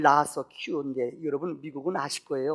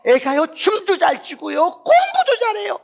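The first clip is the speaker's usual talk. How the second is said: much higher and louder, gushing; excited bragging; 11 semitones higher, 10 dB louder than usual. Acted. Mother brags about her daughter's Christmas show, thrilled. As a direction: pile up the praise, rising to a squeal. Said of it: "막 신이 나는 거예요".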